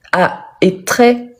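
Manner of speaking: usual speaking voice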